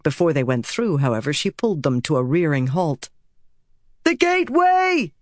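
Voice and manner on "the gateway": high, urgent rallying shout; shouted order. A narrator's reading. Woman to the others amid chaos, shouting. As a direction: shout to be heard by everyone. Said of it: "she shouted at the others".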